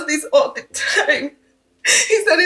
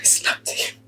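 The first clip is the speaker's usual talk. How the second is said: hushed, breathy admission of guilt; ashamed whisper to a friend; whispered confession; 7 dB quieter than usual. Acted. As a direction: confess it under your breath.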